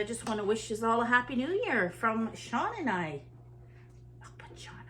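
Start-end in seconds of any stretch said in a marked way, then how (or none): 4.2-4.8 quick whispered aside; breathy whispered aside to the camera. Real, not acted. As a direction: whisper it so he doesn't hear. Aside before handing the phone over.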